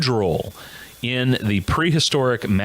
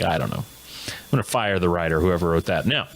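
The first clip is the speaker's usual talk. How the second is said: normal talking voice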